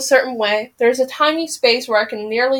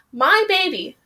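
voice breaking; 7 semitones higher than usual. Real, not acted. Reader choking up mid-passage.

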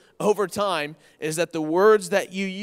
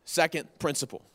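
ordinary talk

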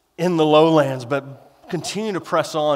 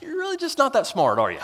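high, pitying tease; playful put-down to the sheep; mock condescending; 7 semitones higher, 5 dB quieter, faster than usual. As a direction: talk down to a dim sheep, higher. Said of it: "What he's really saying is".

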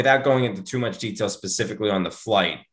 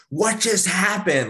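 higher, slower worried question right up close; urgent in my face; 11 semitones higher, slower than usual. Retold or imitated. Wife asking her husband after he fainted, alarmed. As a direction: ask it anxiously, face to face. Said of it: "Like, in my face".

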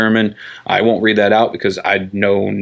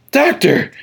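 high-pitched mock outrage; scandalized gasp. Mother shocked at the doctor's swearing, scandalized. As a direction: exclaim it high like an appalled mother.

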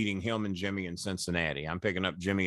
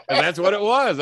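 laughing; 11 semitones higher, 8 dB louder than usual. Real, not acted. Laughing at the memory.

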